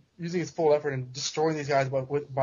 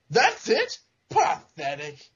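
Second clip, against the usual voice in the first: villain scorn; 3 dB louder, much slower than usual. Retold or imitated. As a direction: sneer at the dying man. Jackal scorns dying Kane.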